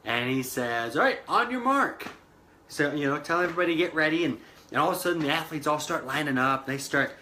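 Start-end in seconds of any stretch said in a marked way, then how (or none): 1.0-2.1 race official. Retold or imitated. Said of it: "an official comes out".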